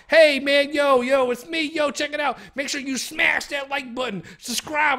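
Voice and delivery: mock a bro hyping his channel; YouTuber intro voice. Mocking a YouTuber begging viewers to subscribe, hyped-up.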